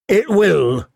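high, hearty jester's voice; huge jester. A narrator's reading. Jester agreeing to sing for his lady, hearty. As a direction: answer as a big clownish fool. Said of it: "the huge jester replied".

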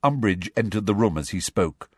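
plain reading voice

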